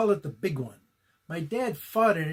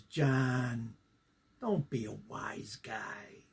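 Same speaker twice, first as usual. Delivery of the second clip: soft, slow whisper, much lower; whispered scolding; 6 semitones lower, 7 dB quieter, much slower than usual. Retold or imitated. Dad scolding his son in a slow whisper. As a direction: hiss the line under your breath. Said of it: "And he looked at me and he goes".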